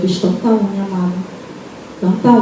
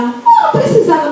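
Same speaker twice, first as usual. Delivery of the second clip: high, quick acted protest; polite protest; much faster than usual. Cleaner protests being paid at once, high quick surprised voice. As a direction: protest fast in a raised, surprised pitch.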